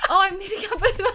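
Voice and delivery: high, rushed reading breaking into laughter; laughing too hard. Real, not acted. Host attempting the listener's question, helplessly laughing. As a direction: try to read through a giggle fit. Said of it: "I'm laughing so hard".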